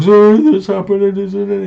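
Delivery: high, loud wailing apology; mock crying. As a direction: blubber it out in a hammed-up wail. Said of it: "crying. He's like".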